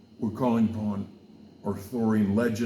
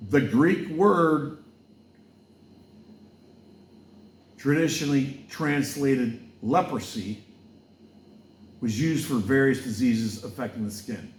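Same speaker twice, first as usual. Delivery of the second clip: preaching; 3 semitones higher than usual. Acted.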